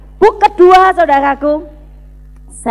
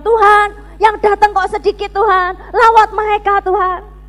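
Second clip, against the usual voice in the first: raised, higher-pitched plea to God; crying out in prayer; 5 semitones higher than usual. Retold or imitated. Student praying after each service, crying out. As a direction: re-enact a fervent cry to God. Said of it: "saya selalu menjerit".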